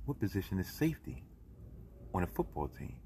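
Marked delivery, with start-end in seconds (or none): none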